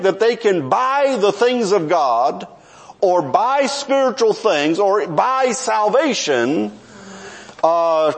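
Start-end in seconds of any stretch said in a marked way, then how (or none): none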